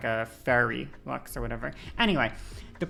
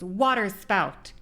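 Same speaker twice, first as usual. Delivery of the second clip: higher, slow, half-hearted battle cry; imitating a spell yell; 6 semitones higher, slower than usual. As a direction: call out the spell like the mage heroine, held back. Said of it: "she goes ... She yells one of those two things".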